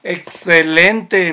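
"Excelente" stretched slow and loud; drawn-out loud praise. Real, not acted. Coach praises skating kids. (elogio alargado y fuerte)

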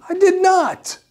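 high, emphatic denial; indignant. Real, not acted. Audiologist denying he told the patient to stop, indignant. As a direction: flatly reject the accusation.

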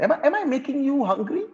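high, teasing question; teacher joking after listing snacks; playful tease. Real, not acted. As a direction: tease the hungry students. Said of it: "playful tease".

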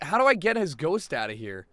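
ordinary talk

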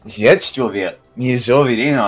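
cutesy, softened uwu Russian. Shown as a demonstration. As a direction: coo the Russian in uwu baby talk. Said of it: "in Russian uwu".

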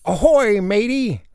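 high, loud play-pirate greeting; pirate hail. Acted. Boy playing pirate hails his sister, loud hail.

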